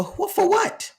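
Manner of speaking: high, ringing disbelief on "what", rising at the end; incredulous. Real, not acted. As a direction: throw "for what?" up high as if you can't believe it. Incredulous question at his audience.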